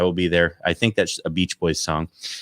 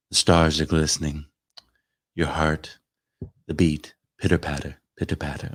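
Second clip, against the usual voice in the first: calming bedtime voice; 4 semitones lower than usual. Pretend. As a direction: lull them to sleep like a calming app. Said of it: "do my little calming scenario".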